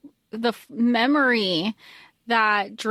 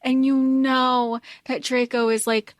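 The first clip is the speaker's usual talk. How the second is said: ordinary talk, nothing marked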